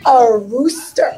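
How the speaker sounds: ordinary delivery, nothing marked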